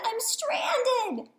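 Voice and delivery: high, near-crying frog; stranded frog crying out; panicked cry. Acted. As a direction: wail it in despair.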